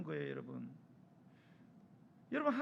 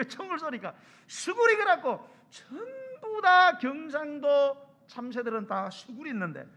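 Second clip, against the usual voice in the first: ordinary talk, nothing marked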